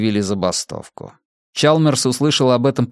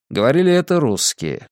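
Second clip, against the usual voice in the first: usual speaking voice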